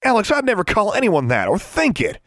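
high, loud, aghast; horrified protest. A narrator's reading. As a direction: protest in shock at the accusation. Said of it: "looking horrified".

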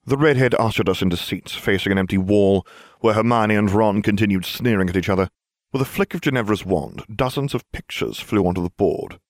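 ordinary delivery

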